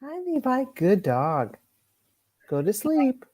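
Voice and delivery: high, sweet voice for a pet. Real, not acted. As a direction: coo at the puppy. Cooing at the dog.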